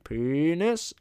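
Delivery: loud, high, rising stretch; winning game call. Retold or imitated. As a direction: call it out long and high to win. Principal's final winning call.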